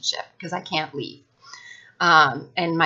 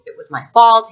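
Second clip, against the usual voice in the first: ordinary talk, nothing marked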